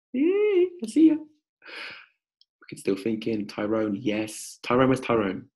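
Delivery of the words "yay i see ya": squeaky high delighted yay; excited greeting. Real, not acted. Coach greeting Sarah on camera with high delight. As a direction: greet her with a burst of joy.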